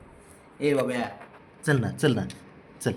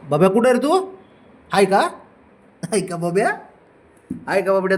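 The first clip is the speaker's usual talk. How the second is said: loud, higher call across the house; raised searching call; 6 semitones higher, 5 dB louder than usual. Real, not acted. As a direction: call the hiding dog to come out. Calling the hidden dog.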